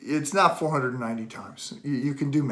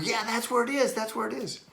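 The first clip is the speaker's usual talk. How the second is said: much higher, strained-sounding reenacted reply; pained confirmation; 10 semitones higher than usual. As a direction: act out yelping that the sore spot is found. Acting out pain when pressed.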